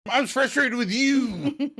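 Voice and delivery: very high, slow mock outburst; Monica, exasperated. Monica blaming Chandler in a quarrel, exasperated. Acted quarrel, frustration escalates.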